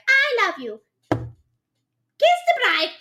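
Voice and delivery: high, loud mock of a lazy groom; mocking husband. Mocking the future husband's tiny wedding vows, high and loud. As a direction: squeak it like a careless husband reading a Post-it.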